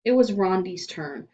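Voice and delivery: plain reading voice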